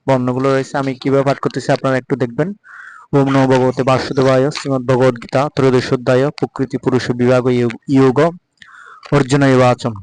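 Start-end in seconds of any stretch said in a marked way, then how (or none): 3.1-9.9 measured, devout reading of the Gita; Sanskrit scripture recitation. Acted. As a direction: recite the verse carefully, every letter sounded.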